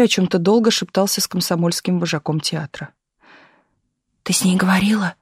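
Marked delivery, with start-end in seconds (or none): 4.2-5.1 hushed whispered question. A narrator's reading. Pasha asks Taisia during the meeting, whispering. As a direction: ask under your breath. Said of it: "прошептал Паша Таисии".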